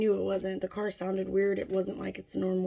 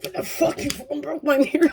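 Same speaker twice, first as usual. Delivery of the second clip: mock outrage, raised pitch; angry accusation; 5 semitones higher, 5 dB louder than usual. Dad finds his mirror broken, mock-angry outburst. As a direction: imitate him blowing up in accusation.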